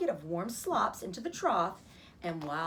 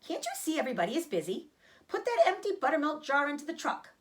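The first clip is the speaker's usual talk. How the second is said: fast, higher and firm order; commanding; 7 semitones higher than usual. Acted. Mr Arable orders Fern about the buttermilk jar, fast and firm. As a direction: give the order quickly. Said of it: "commanded Mister Arable".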